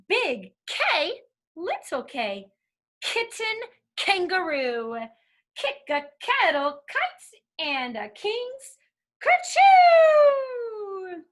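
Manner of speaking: deliberate, high-pitched picture-book reading; reading to children. Acted. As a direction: read playfully, building to a big loud sneeze word.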